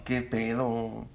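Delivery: drawn-out, laid-back qué pedo; slow cool street drawl. Shown as a demonstration. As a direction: stretch it out lazily. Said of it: "o así".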